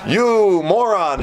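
high, very drawn-out jeer; mock insult. Wife berating her husband, mock scornful. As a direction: stretch each word in a hammed-up scolding.

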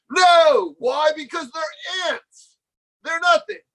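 high-pitched, incredulous exclamation; emphatic outcry. Real, not acted. Teacher rejecting ego boost from ants, emphatic outcry. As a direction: burst out loud on the first word, stay high. Emphatic answer to own absurd example.

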